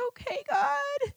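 high, thin, slow giving-in; tearful surrender. Retold or imitated. Answering God's call to forgive, tearful high surrender. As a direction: softly yield on the edge of tears. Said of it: "So through tears".

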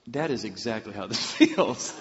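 amused prayer breaking into laughter; laughing realization. Retold or imitated. Retold prayer while both are laughing.

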